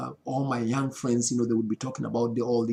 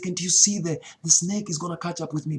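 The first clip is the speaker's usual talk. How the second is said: higher and louder, urgently pleading for help; frantic call; 7 semitones higher, 4 dB louder than usual. Retold or imitated. Telling his mom in the dream to see the snake, frantic higher call. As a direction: call out in a retold panic.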